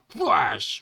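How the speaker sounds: punched vocal whoosh; comic-book attack noise; slash sound effect. Acted. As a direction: voice a sword cut. Imitated sword attack noise.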